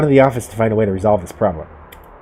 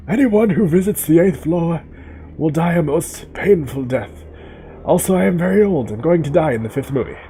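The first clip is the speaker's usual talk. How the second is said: Dumbledore voice; 7 semitones higher than usual. Retold or imitated. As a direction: intone it like an ancient headmaster. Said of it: "Good old Dumbledore".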